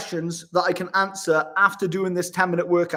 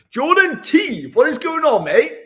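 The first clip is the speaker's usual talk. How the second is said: excited greeting; 8 semitones higher, 7 dB louder than usual. Real, not acted. Greeting a viewer from the chat, loud and excited. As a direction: call out his name like spotting a mate.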